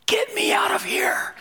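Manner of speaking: hammed-up whisper, very high and strained; mock stage whisper. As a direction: imitate an urgent whisper in someone's ear. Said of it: "she whispers in my ear".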